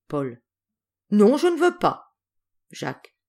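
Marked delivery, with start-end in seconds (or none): 1.1-2.1 put-on high, loud child's no; stubborn refusal. Paul refusing the bullies' apology, high and loud. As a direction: shout a flat refusal.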